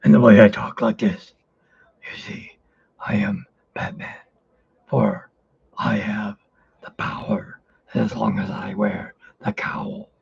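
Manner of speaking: masked hero declaring himself in broken phrases, hushed; Batman. Acted. Batman proclaiming the power of the cowl, strained whispery voice. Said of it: "the other one was Batman".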